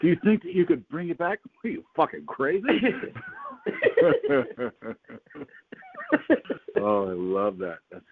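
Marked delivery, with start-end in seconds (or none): 0.0-2.6 high-pitched playful re-enactment; mock incredulous. Host replaying the dream dialogue, mock incredulous. 2.7-5.4 long high laugh. Real, not acted. Host tickled by the dream dialogue, laughing.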